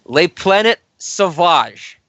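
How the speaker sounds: best attempt at a French accent, slow and emphatic. Shown as a demonstration. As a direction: draw it out in a put-on French accent. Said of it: "my best French accent here".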